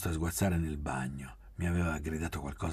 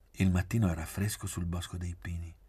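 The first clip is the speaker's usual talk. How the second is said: usual speaking voice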